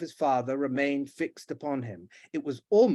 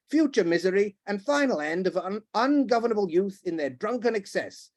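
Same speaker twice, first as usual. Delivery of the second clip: higher, heated sermon; pompous tirade; 7 semitones higher than usual. Acted. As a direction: declaim in outrage. The Duke in full gallop.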